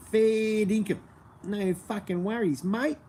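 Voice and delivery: broad Australian Bogan drawl, slow, high mate; Bogan accent. Shown as a demonstration. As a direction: lay on thick Aussie Bogan, slowly. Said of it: "accurate Bogan in, interpretations".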